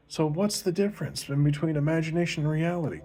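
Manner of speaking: put-on Susie, a little girl, asks Johnny eagerly; eager little girl asking. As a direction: pitch up and push the question like a kid. Susie repeats Johnny's question.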